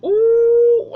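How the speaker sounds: high, loud ooh of shock. Retold or imitated. Hearing Cliff's insult to Jane, a high loud shocked ooh. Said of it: "And I was like".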